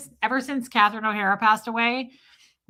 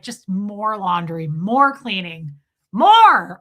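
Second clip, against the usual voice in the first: escalating eagerness; 8 dB louder, much slower than usual. Retold or imitated. Patient craving productivity, eager building shout. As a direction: stretch each item, then burst on the last. Escalating list of what she'd do.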